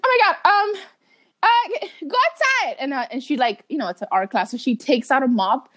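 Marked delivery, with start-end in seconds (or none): 0.0-2.8 high, flustered teacher voice. Retold or imitated. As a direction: send the kid out in a panic. Teacher ordering her out.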